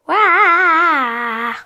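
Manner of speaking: loud high startled cry; surprised yell. Real, not acted. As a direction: yell out in shock. Sudden shock in game.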